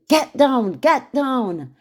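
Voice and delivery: urgent high, loud shouted command; Secret Service shout. Retold or imitated. Secret Service ordering dinner guests to take cover, urgent shout. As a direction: shout it like an agent clearing a room. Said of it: "Secret Service shouting".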